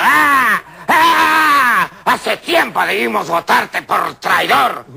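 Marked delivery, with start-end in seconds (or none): 0.0-1.9 loud high angry screams; furious shrieks. Acted. Rival woman screaming at the doctor in fury. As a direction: scream with rage.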